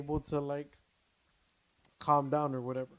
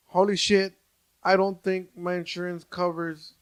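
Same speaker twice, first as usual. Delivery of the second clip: a sudden loud burst of alarm; alarmed exclamation; 8 dB louder than usual. Retold or imitated. Telling the host how he panicked, loud alarmed outburst. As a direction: blurt it out like panic just hit.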